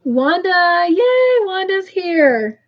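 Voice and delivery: high, bright squeal on yay; thrilled to see Wanda arrive; excited greeting. Real, not acted. Host cheering as Wanda joins the live.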